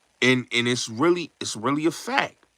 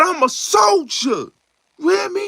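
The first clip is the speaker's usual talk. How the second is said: hyped shout; 3 dB louder than usual. Retold or imitated. Retelling [name]'s lines from Juice.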